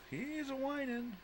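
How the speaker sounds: very high, whiny voice; mock whine. Owner mimicking her whining dog, very high whine. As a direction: imitate the dog's whine, way up high.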